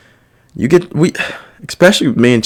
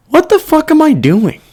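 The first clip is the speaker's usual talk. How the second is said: much higher, mock-exasperated self-talk; incredulous inner voice. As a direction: ask yourself in disbelief, pitched way up. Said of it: "I was like".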